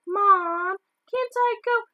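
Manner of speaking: little sister begging mum to let her go; pleading little girl. Acted. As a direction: beg like a small girl left out. Small girl begging her mother.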